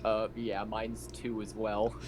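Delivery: ordinary talk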